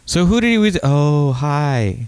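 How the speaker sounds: high, chirpy mock small talk; awkward introduction. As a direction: sound awkwardly bright meeting her. Voicing awkward new girlfriend date.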